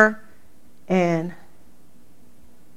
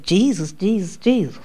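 mocking imitation; 6 dB quieter than usual. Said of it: "The thing mocked me".

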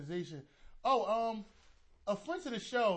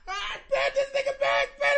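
laughing hard; 13 semitones higher, 6 dB louder than usual. Real, not acted. Laughing at friend choking.

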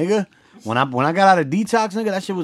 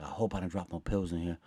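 much softer, lower, half laughing; guilty whisper to self; 8 semitones lower, 12 dB quieter than usual. Retold or imitated. Car search, hoping aloud no pills fell, very quiet. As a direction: barely say it, nervous and guilty. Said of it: "I was like".

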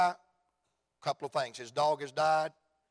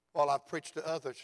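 ordinary talk

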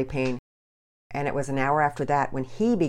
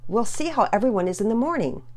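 loud, high, sharp scolding; impatient exclaiming; 7 semitones higher, 7 dB louder than usual. Acted. Mother losing patience with her pestering daughter, loud scold. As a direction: shut the question down hard. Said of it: "grew impatient and finally exclaimed".

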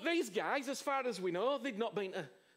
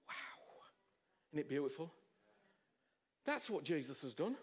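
softer and lower, awed whisper; hushed awe; 10 semitones lower, 7 dB quieter than usual. Real, not acted. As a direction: let the wonder sink in. Hushed awe after the story.

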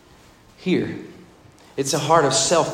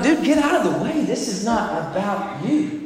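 annoyed wedding crowd; 5 semitones higher than usual. Retold or imitated. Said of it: "Everybody be like".

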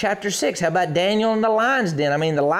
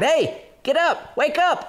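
higher, slow insistent wake-up call; hammed-up angel rousing sleeper; mock urgent wake-up; 8 semitones higher, slower than usual. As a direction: jolt him awake, playful. Angel strikes Peter awake.

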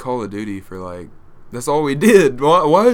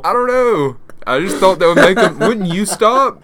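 laughing; 7 semitones higher, 4 dB louder than usual. Real, not acted. Defending her prank, laughing.

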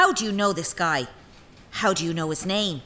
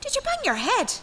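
high, disbelieving jab; incredulous jab. Acted. Maid scolding her mistress, high and incredulous. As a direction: question her sanity.

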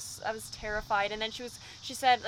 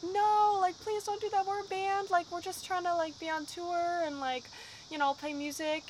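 higher, begging voice, retelling the panic; mock pleading; 7 semitones higher than usual. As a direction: plead with the cop, half-acted, pitched up. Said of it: "And we're like".

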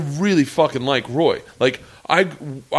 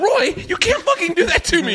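exasperated plea. Retold or imitated. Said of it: "I go".

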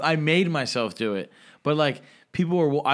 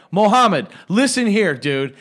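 put-on loud, high drunken telling-off; forceful call-out, dropping at the end; retold cursing out; 6 semitones higher, 8 dB louder, slower than usual. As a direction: re-enact chewing someone out. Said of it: "I was cursing this guy out".

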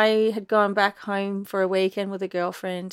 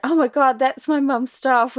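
louder, raised-pitch disbelief; retold shock; 4 semitones higher, 6 dB louder than usual. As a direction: relive the stunned outburst. Retelling shocked discovery in op shop.

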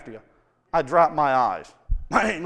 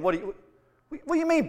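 normal talking voice

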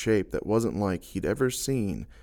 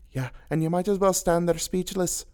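shouted; 9 semitones higher than usual. A narrator's reading. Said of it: "she shouted".

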